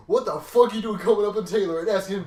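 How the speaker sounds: very loud, high furious yelling; furious manager yell. Retold or imitated. Manager Noah yelling at the coworker for asking Taylor, furious loud yell. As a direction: bellow it at the coworker, outraged. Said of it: "And Noah would be like".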